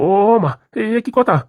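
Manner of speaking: high-pitched shocked protest; appalled exclamation. Acted. Husband told to use a bucket, appalled exclamation. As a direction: cry out in dismayed disbelief.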